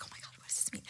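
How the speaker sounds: whispered inner freak-out; very soft, hushed mock panic; panicked whisper. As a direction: whisper it to yourself in alarm.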